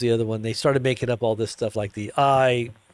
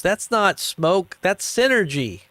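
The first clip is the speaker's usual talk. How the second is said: higher, slow smug spin; mock salesman spin; 6 semitones higher, slower than usual. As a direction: sell the disaster as a feature. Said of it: "he goes".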